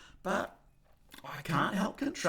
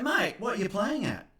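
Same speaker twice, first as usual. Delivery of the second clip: higher, indignant mock confrontation; confronting Albo; 9 semitones higher than usual. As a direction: challenge your mate, a bit hammed-up. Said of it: "and say".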